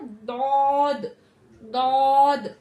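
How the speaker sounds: दौद drawn back, very slow and loud; teacher showing Daad slowly; Daad demo. Shown as a demonstration. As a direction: pull the tongue back and stretch दौद. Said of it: "पीछे की तरफ उसको ले जाना है".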